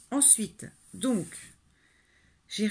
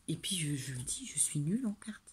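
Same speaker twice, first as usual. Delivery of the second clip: whispered confession; 3 semitones lower, 6 dB quieter than usual. Real, not acted. Crafter whispering to her viewers that she's bad at cards, whispered. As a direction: whisper it as a secret, no voice at all.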